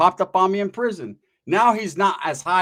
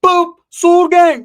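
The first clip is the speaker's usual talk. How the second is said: high, very loud yell; gang shout; 14 semitones higher, 13 dB louder, much slower than usual. Acted. Attacker yelling mid-fight in a retold jumping, high gang shout. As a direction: shout the gang's name as a war cry.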